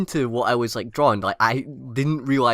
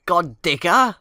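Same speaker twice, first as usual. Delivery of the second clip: put-on raised, questioning English-accent voice; 5 semitones higher, 4 dB louder, slower than usual. Likening the word to an English person, English accent. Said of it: "like some, some English person".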